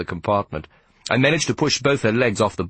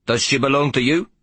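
accusing; 6 semitones higher than usual. Acted. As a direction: point the blame at the boy. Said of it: "he asked in an accusing voice".

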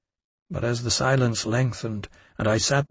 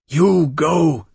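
louder, higher fierce order; growled; 5 semitones higher, 6 dB louder than usual. A narrator's reading. Innkeeper drives Louis away, fierce growl. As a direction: growl it at him. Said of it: "she growled".

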